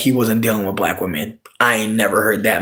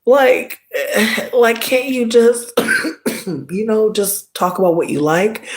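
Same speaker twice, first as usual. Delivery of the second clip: exasperated plea; 9 semitones higher, slower than usual. Retold or imitated. Exasperated retort to rude man.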